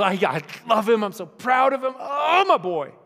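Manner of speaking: God doting on his son, gushing proudly; bubbling over with love. Retold or imitated. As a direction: overflow with fatherly delight. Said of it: "bubbling over and oozing love".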